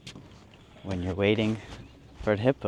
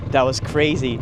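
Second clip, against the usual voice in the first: usual speaking voice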